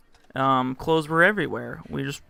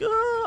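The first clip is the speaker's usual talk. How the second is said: high-pitched imitation squeal; mock scream. Redoing her moan for viewers, mock high scream. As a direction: put on a high, squealy cry. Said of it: "that was screaming".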